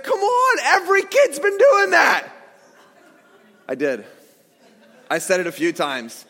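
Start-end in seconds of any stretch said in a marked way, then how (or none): 0.0-2.3 much higher, indignant yell; yelling at ref. Retold or imitated. An upset father yelling at the referee over a travel call, much higher. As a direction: protest hard at the ref. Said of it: "I yelled at the ref".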